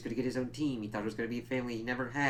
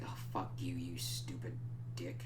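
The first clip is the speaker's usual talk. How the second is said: muttered insult; 5 semitones lower, 10 dB quieter than usual. Retold or imitated. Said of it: "It's like".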